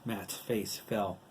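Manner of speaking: usual speaking voice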